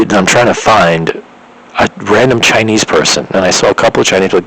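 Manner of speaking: ordinary talk, nothing marked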